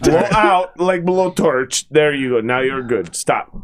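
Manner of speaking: slow mock Russian accent; instructing in a Russian accent. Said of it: "He did the, the Russian accent".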